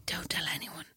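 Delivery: very soft, voiceless whisper; conspiratorial whisper. Retold or imitated. Mother slipping child two squares of chocolate, secretive whisper.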